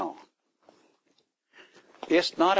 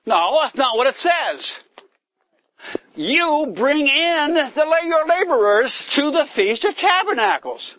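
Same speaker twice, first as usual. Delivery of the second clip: loud, high, forceful correction; emphatic rebuttal; 10 semitones higher, 5 dB louder than usual. Real, not acted. Preacher correcting the congregation, loud forceful rebuttal. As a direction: hammer the command home.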